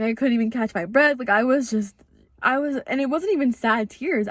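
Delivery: ordinary talk, nothing marked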